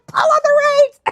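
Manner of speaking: very high, loud insistent mock yell; urgent shouted order. Little friend insisting to the scared rider, high mock yell. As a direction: repeat the command at a frantic pitch. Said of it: "talking to me like this".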